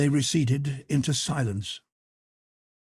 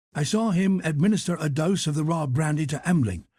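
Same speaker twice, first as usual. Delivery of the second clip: usual speaking voice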